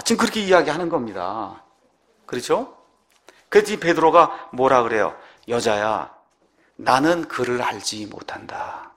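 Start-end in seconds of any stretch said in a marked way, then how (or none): 5.5-8.9 low, slow, softened denial; lightly acted denial, drawn out and low; solemn denial. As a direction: deny it slowly in a lowered voice. Voicing Peter's denial to maid.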